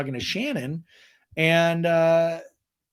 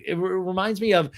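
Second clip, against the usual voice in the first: ordinary delivery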